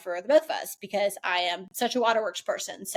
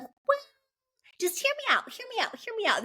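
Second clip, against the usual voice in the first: much higher, coaxing mock mom; mother pleading to be heard out, in a much higher imitated voice; imitating mom; 14 semitones higher, faster than usual. Mother begging her daughter to listen, in a much higher pleading voice. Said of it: "And she's like".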